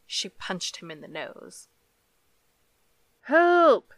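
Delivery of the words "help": desperate shout for help, much higher and louder; yelled. A narrator's reading. Drowning man yelling for help, a desperate loud shout. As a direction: scream for help as you're dunked. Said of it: "he yelled".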